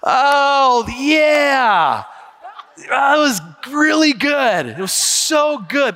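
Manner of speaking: hammed-up blank enthusiasm; vague churchgoer. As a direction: bluff because you forgot the sermon. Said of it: "you go".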